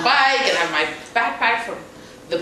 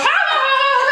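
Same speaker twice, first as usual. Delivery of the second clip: a high, loud yelp that breaks into laughter; laughing yelp; 7 dB louder than usual. Retold or imitated. After crashing her bike, a high laughing squeal. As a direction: she squeals through a laugh at her own fall. Lying on floor after fall.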